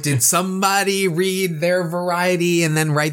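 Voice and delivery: knowing, hammed-up uh-oh reaction; teasing suspicion. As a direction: raise the pitch as if spotting someone's secret. Acts out own suspicious reaction.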